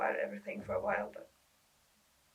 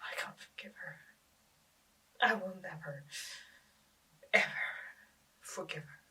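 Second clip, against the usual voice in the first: quiet bitterness; 3 dB quieter than usual. Acted. Girl refusing to forgive her dead sister, hushed and bitter. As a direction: drop to a near whisper, word by word.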